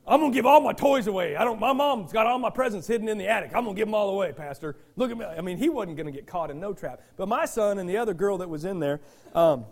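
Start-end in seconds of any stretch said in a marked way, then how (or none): 0.0-5.2 put-on boasting kid. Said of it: "caught in this trap before".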